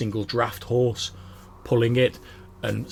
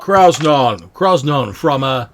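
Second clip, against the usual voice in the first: hearty dwarf; 5 semitones higher, 11 dB louder than usual. Acted. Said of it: "He's like".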